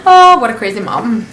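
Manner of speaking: normal talking voice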